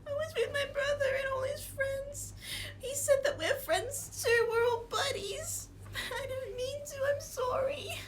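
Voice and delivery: put-on high, teary kid voice; crying child. Kid confesses about the dog, crying. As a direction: weep it out like a guilty little boy.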